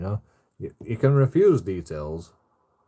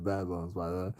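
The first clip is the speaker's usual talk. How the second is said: ordinary talk, nothing marked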